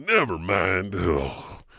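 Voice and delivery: ordinary delivery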